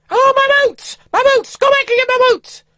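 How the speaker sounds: very high, loud, panicked wife voice; wife crying out for her lost boots; shrieking wife. Retold or imitated. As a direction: yell to go back for them. Wife discovers boots left behind.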